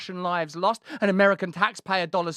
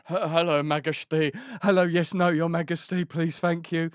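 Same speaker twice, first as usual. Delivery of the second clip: flustered politeness; 5 semitones lower than usual. Retold or imitated. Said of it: "did meet Her Majesty".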